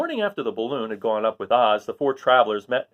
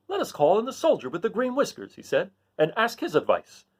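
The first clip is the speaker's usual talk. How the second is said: high Scarecrow suggestion; lightly voiced straw man; 8 semitones higher than usual. Scarecrow calling for the soldier.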